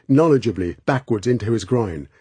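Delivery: ordinary delivery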